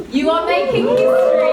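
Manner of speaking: big, high, loud proclamation; excited shout. Real, not acted. Host rallying the audience at the start, excited shout.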